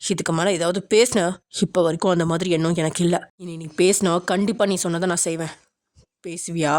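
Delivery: low-pitched, controlled threat; menacing man. A narrator's reading. Angry man warns her against talking, low and threatening. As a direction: warn her in a deep, stern male voice.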